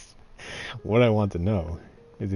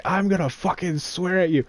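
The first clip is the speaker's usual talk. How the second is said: higher-pitched mock drill instructor tirade; imitating a drill instructor; 8 semitones higher than usual. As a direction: lay down the law like a boot camp commander, half in jest. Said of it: "our R D C first day is like".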